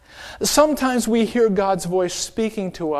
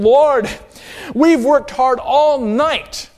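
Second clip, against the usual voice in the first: higher, louder slow tired protest; weary protest; 7 semitones higher, 5 dB louder, much slower than usual. Retold or imitated. Simon tells Jesus they worked all night, slow weary protest. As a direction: plead your exhaustion to the Lord.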